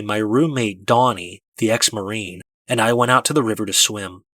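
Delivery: usual speaking voice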